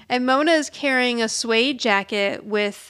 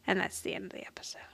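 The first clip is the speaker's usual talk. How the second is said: whispered ending; 3 semitones lower, 14 dB quieter, much faster than usual. Real, not acted. Wrapping up the recap.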